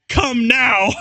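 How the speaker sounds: high shouted stern-teacher voice; hammed-up McGonagall. Reader voicing McGonagall catching them out, shouted high. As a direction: bark it like a scandalised professor.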